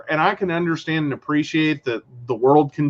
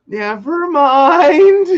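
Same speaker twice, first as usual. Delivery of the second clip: slow, high mock never-mind; sheepish retreat; 10 semitones higher, 6 dB louder, much slower than usual. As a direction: play frozen coworkers backing off.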